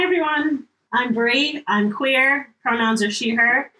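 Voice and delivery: ordinary talk, nothing marked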